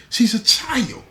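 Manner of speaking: a father's indignant cry. Acted. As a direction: stepfather outraged at the man who exploited her. Angry stepfather insists.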